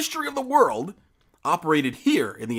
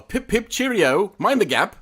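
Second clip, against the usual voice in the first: high, slow mock British; hammed-up posh English; British accent; 9 semitones higher, 5 dB louder, slower than usual. Shout out to UK listeners.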